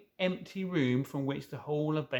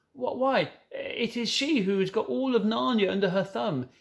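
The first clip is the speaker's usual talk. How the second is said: put-on higher character voices in a children's story; fawn and girl voices; 6 semitones higher, 4 dB louder than usual. A narrator's reading. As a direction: read the dialogue in lively character voices. Narrator voices Lucy and the fawn.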